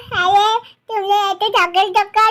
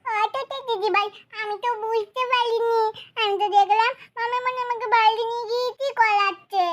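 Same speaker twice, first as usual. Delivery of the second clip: much softer, sheepish excuse; softly pleading innocence; meek explanation; 10 dB quieter than usual. Acted. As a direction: explain yourself in a small, quiet voice. Child explaining the bedwetting dream.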